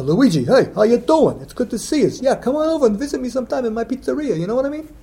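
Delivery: put-on high-pitched, hearty New York and Boston accent; Luigi's New York-Boston accent. Cousin Luigi inviting someone to his pizzeria, New York-Boston accent. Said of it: "combination of a New York and a Boston accent".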